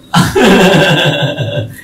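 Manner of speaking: real laugh after the joke. Real, not acted. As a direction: burst out laughing at the jab. Tertawa setelah ejekan. (tertawa lepas)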